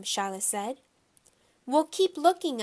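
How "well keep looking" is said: loud, high-pitched order; raised, urgent yell. A narrator's reading. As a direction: yell it at someone searching. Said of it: "I yelled".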